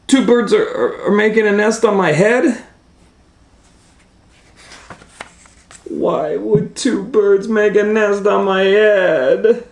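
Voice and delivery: high, loud disbelief; incredulous outcry. Acted. Elephant learning of a nest on his head, high incredulous outcry. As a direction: wail the questions in dismay.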